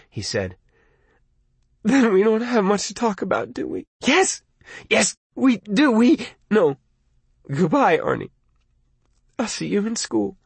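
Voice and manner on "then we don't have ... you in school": upset, breaking off. Acted. Lee ending the call upset.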